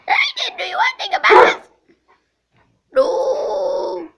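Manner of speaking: very high, loud, laughing screech; pterodactyl shriek. Acted. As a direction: cackle and screech like a dinosaur. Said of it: "Second, this is gonna be funny".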